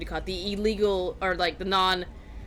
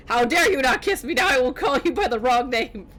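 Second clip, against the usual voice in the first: higher-pitched, playfully outraged scolding; mock indignation; 4 semitones higher, 3 dB louder than usual. Player scolding a game character for withholding a kiss, mock indignant. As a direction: huff in pretend offence, higher and punchy.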